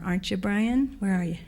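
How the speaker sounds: normal talking voice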